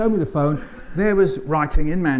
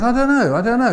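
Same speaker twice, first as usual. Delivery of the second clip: higher, louder son's shrug; 9 semitones higher, 4 dB louder than usual. Retold or imitated. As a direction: play the son, bemused and brushing it off. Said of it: "He said".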